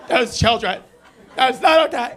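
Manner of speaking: stern teacher, rising. Retold or imitated. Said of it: "looking at me like a teacher".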